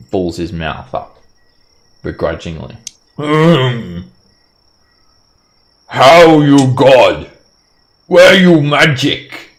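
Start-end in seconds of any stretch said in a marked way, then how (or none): 3.2-9.5 slow, high, loud broken speech; suspicious giant. Acted. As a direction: drag it out like a wary giant. Cyclops scrutinizes begrudgingly.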